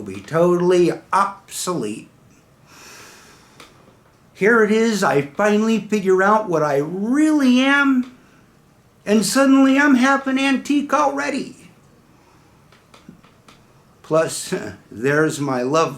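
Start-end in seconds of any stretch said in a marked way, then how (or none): 4.3-11.7 high, loud lament; worked-up cry of unfairness; exasperated protest. Acted. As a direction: complain in raised-pitch disbelief. Acted exasperation at becoming obsolete.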